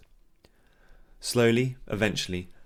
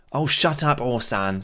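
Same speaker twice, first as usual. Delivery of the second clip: annoyed man; 5 semitones higher, 4 dB louder, slower than usual. Acted. Bystander telling man off.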